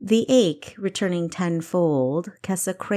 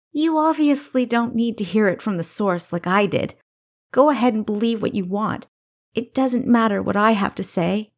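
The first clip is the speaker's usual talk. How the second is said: lightly raised, resentful younger sister; a bitter sister pushing back, a bit higher; Kessa's voice; 5 semitones higher, 4 dB louder than usual. Acted. Kessa hurling bitterness at her older sister, raised.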